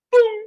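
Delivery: high, loud pop of a pung; mock pung sound. Teacher playfully voicing the finished bibliography appearing. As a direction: burst out a pung like a finish chime.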